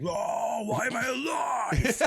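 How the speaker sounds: slow, half-shouted waking despair; mock despair. Waking up and groaning, mock despairing. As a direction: groan it out at the ceiling. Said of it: "and I was just like".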